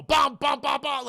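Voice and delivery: loud, high mock "bum, bum" stingers; punched-out imitation of scare music. Mocking scare cues films add to alien attacks, imitated sting.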